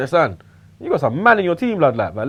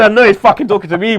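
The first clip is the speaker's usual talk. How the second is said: ordinary talk, nothing marked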